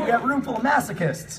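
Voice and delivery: normal talking voice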